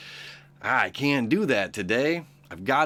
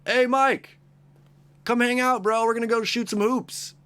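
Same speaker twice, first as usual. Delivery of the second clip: high, loud buddy calling out; distracting friend; 12 semitones higher, 4 dB louder than usual. Friend tempting Michelangelo to play hoops, high loud calling. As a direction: yell up to him like a pal inviting him out. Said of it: "every time someone's like".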